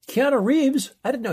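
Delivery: high, loud surprised question. Real, not acted. Co-host surprised Keanu Reeves is in the movie, loud and high. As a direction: blurt it out, surprised.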